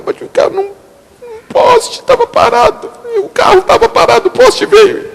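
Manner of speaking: very high crying wail imitating the wife; imitated sobbing. Pastor imitating his sobbing wife after the crash, high crying wail. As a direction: wail it through mock tears. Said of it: "me liga chorando".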